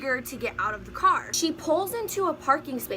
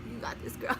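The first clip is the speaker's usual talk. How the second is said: whispered self pep talk; 8 dB quieter than usual. Retold or imitated.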